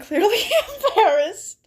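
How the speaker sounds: high, laughing on the word; giggling mid-read. Real, not acted. Laughing while reading.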